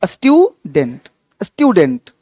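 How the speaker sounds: स्टूडेंट said slowly with a clear "yoo"; slow pronunciation demo. Shown as a demonstration. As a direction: stretch the यू in स्टूडेंट as स्ट्यूडेंट. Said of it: "यू क्या होगा, यू". (स्टूडेंट धीरे से, यू को खींचकर)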